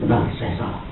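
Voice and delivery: low, soft confession; humble admission. Acted. Person corrected with love admitting fault, soft and humble. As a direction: own up quietly and humbly.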